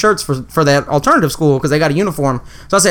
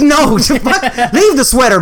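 laughing exasperation; 7 semitones higher, much slower than usual. Retold or imitated. Scolding brother, laughing.

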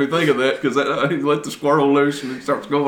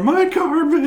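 wailing wife; slower than usual. Retold or imitated. Quoting crying wife.

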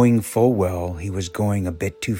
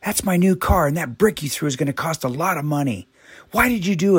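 angry shouting; 9 semitones higher than usual. A narrator's reading. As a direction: yell at the boy who threw the brick, much higher.

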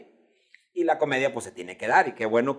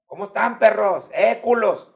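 louder, high rowdy greeting; 8 semitones higher, 9 dB louder than usual. Retold or imitated. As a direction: bark it like a buddy shout. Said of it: "yo no les digo".

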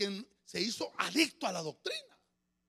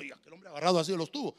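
ordinary delivery

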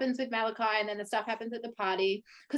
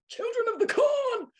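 mock horror cry; 4 dB louder than usual. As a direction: call it out high, stretching the words. Cries out horror film title.

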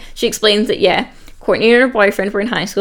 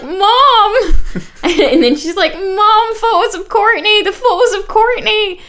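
high, loud mock panicked wail; panicked cry for mom; 11 semitones higher, 4 dB louder, slower than usual. Kim bursting home to her mother, mock panicked wail. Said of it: "She's crying".

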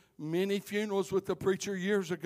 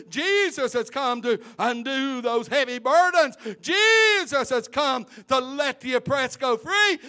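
louder and higher, building to a cry on the last line; fervent preaching climax; 5 semitones higher, 8 dB louder than usual. Real, not acted. Preacher proclaiming deliverance to the church, building to a cry. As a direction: pour everything into the climax, voice breaking.

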